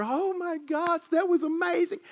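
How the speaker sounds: high, gushing mock wife; imitating gushing wife. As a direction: bubble over like the wife leaving church. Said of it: "my wife is just bubbling over".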